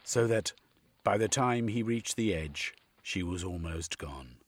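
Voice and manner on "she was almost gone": usual speaking voice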